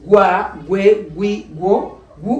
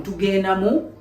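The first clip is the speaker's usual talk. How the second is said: ordinary talk, nothing marked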